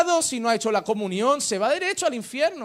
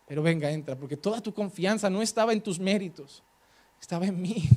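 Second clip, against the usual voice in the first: low, soft voice of a forgiving Jesus; hushed, merciful reassurance; tender welcome; 7 semitones lower, 7 dB quieter than usual. Acted. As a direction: drop right down and quietly let them in. Voices Jesus welcoming sinner by grace.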